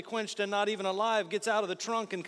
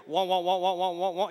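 rumble strip noise; 3 semitones lower than usual. Retold or imitated. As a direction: mimic the tyres on the rumble strip. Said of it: "I hear".

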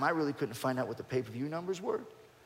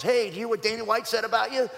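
higher, louder eager reporter bait; goading reporter; 9 semitones higher, 6 dB louder than usual. Retold or imitated. TMZ reporter calling to stir up a fight, higher and louder. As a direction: egg him on like a gossip reporter. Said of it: "Trying to get me fired up".

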